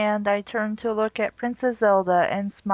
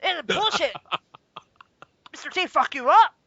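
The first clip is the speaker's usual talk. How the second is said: Mister T impression; 3 semitones higher than usual. Mock Mister T voice.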